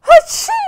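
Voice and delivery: loud high fake sneeze "Aching!"; hammed-up playful achoo; mock sneeze. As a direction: pretend to sneeze, big and teasing. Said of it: "I'm teasing also".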